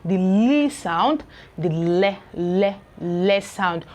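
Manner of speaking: li and le drawn out slowly with the tongue lifted; very slow teacher demo of the L; slow L sound. Shown as a demonstration. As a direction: stretch the L sound for the learner. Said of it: "when to lift up the tongue".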